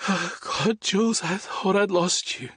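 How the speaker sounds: high, cracking tearful words; nearly breaking down. Acted. Liam telling Jules he feared losing him, voice breaking. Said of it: "His voice cut up and he took a shuddering breath".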